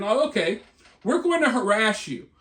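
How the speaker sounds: ordinary talk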